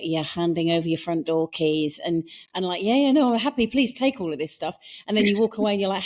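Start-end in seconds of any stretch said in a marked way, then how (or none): 2.8-4.8 charmed pushover. Retold or imitated. Naive victim handing over everything.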